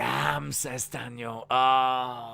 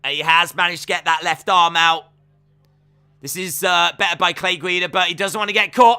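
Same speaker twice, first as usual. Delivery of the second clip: ordinary talk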